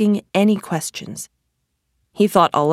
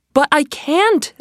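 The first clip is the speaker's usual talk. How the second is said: put-on pleading boy; 9 semitones higher than usual. A narrator's reading. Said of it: "Aladdin said".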